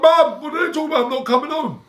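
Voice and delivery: squeaky-high, rushed plea to mum; mock bawling. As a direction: imitate a crying kid begging his mother. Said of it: "I bawled".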